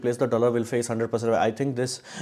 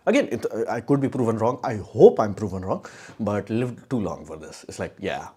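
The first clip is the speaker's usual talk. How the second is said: normal talking voice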